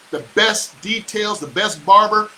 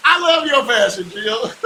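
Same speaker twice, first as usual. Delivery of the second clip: ordinary talk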